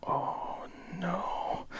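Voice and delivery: breathless whispered "oh no" of dread; whispered dismay. Retold or imitated. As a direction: gasp it out in horror at the chair. Elevator person sees the chair.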